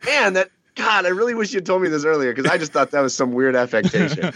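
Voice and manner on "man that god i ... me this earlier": whiny son voice. Retold or imitated. Son voiced complaining to dad.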